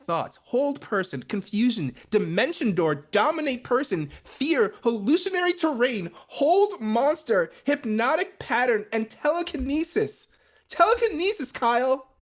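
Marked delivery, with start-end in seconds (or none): none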